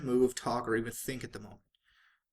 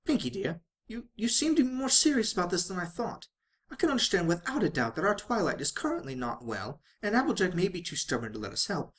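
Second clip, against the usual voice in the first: higher, genteel voice, gently probing; a fussy fashionable mare coaxing a friend; refined lady pony; 6 semitones higher, 3 dB louder than usual. Acted. Rarity questions a serious Pinkie.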